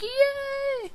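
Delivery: very high squeaky cheer; mock cheer. Gamer voicing the wall-sitting winner, very high squeaky cheer. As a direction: squeal it like a kid who won. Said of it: "He's like".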